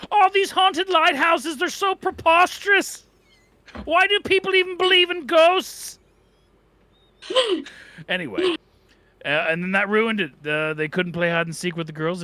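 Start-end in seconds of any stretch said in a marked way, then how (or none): 0.1-8.6 Jordan Peterson. Pretend. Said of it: "I turned into Jordan Peterson".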